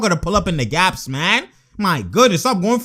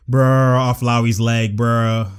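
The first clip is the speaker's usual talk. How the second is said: ordinary delivery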